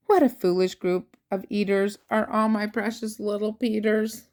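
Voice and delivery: usual speaking voice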